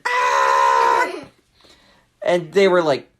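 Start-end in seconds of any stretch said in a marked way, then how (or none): 0.1-1.1 mock panicked "aah" yell; fleeing turtles' scream. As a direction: yell as if running for safety. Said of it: "baby turtles were running".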